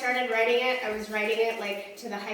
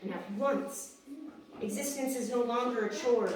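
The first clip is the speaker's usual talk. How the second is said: measured, dramatic recital that swells into urgent peaks; rhythmic poem delivery, building and easing; spoken-word poetry; 4 semitones higher than usual. Acted. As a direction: perform it like slam poetry, pausing between images. Performs own spoken-word poem.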